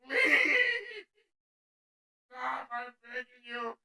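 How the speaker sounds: tiny, faint mock begging; six-year-old pleading. Six-year-old pulling back on a racing horse, faint helpless pleading. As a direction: beg feebly in a very soft child's voice. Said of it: "my six-year-old strength, like".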